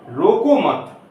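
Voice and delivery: रोको मत joined, high and loud on रोको; pause after मत. Shown as a demonstration. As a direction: say रोको मत as one firm unit and stop. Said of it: "comma लगा दें". (रोको मत एक साथ, ज़ोर से)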